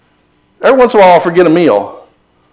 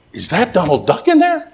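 playful doctor; 7 semitones higher, 3 dB quieter than usual. Retold or imitated. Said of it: "trying to put her at ease".

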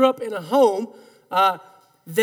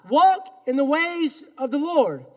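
usual speaking voice

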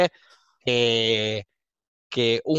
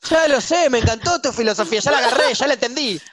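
high-pitched impatient outburst; exasperated; 7 semitones higher than usual. Acted. Professor fed up with student's philosophy, high exasperated voice. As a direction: rattle off that you have got it already.

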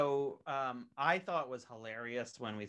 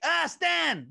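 put-on much higher, louder Irish accent; high mock Irish accent; Irish Jeff Van Gundy; 13 dB louder than usual. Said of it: "a little higher pitched and sort of Irish".

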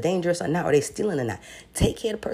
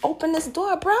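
mock crowd pleading; 12 semitones higher than usual. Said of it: "the store was looking like".

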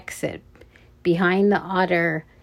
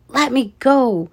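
struggling protest; 5 semitones higher, 4 dB louder than usual. A narrator's reading. Noah struggles to get loose.

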